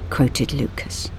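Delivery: ordinary delivery, nothing marked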